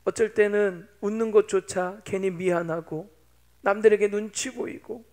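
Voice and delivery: ordinary delivery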